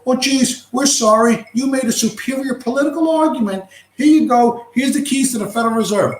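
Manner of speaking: high-pitched mock apology; mocking surrender. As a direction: play a hammed-up, grovelling concession to ridicule the idea. Mocks imagined surrender by the powerful.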